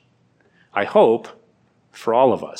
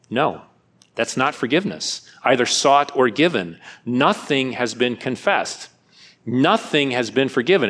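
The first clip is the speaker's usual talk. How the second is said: usual speaking voice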